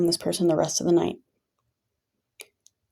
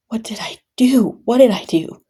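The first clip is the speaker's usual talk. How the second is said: higher, louder plea against blame; exasperated protest; 5 semitones higher, 5 dB louder than usual. Retold or imitated. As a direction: protest the blame, voice lifting on each question. Protesting being blamed for the hospital stay.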